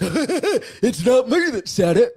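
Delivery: sneering, laughing, slow mock voice; a hammed-up mock of a smug rival; mocking impression. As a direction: parody his smug denial with a laugh. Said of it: "Why he laughed".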